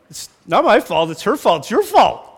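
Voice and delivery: rising to a high whiny your fault; mock defensive blaming. Spouse dodging blame, building to high whine. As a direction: pass the blame around. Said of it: "You know".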